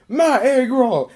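drawn-out high yell; retold loud holler. Retold outburst to a room.

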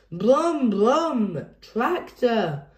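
put-on a small boy shouting for his tractor; toddler cry. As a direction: George answering eagerly. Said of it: "cried George".

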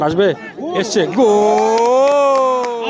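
building into a long loud goal cry; goal call. Real, not acted. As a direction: rise and stretch out the goal. Commentator sees the shot go in.

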